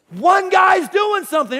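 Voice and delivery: high, slow, very loud exclamation; acted-out elated yell; excited shout. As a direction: shout it out in thrilled approval. Jesus' thought acted out, excited.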